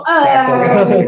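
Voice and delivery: panicked cry. Acted.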